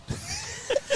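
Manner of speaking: very high breathy giggle; high soft laugh. Real, not acted. Billy laughing at his own coffee story, high giggle. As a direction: let a high giggle slip out.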